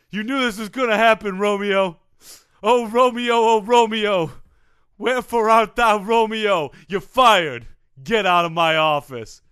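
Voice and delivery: exasperated boss. Acted. Boss firing Romeo in a staged recording, loud and theatrical. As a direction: build to a loud, dramatic dismissal.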